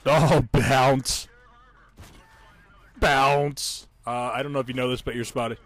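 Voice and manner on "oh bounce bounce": loud, punched announcer voice; announcer call. Pretend. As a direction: boom it out like a game announcer. Announcing shells bouncing off.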